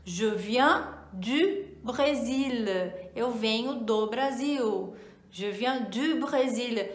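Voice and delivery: "je viens du Brésil" said slowly; slow careful "je viens du Brésil"; slow French demo. Shown as a demonstration. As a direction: model French sentence. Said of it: "por exemplo".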